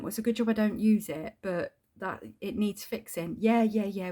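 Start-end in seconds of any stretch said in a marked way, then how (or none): 3.4-4.1 lightly voiced, louder brush-off; hint of a bored landlord saying yes yes; mock dismissive landlord. Mocking landlord brushing her off.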